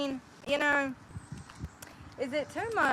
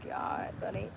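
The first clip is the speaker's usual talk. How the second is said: usual speaking voice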